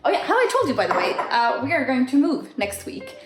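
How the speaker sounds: usual speaking voice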